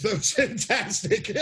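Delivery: slow, drawn out through laughter; laughing praise. Real, not acted. Host praising a friend's Scottish accent, laughing. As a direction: let the laugh break into the compliment.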